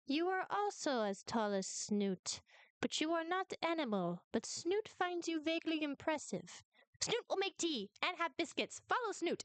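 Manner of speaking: measured verdict rising into a high, grand invitation; Snoot. Acted. Game master voices Snoot.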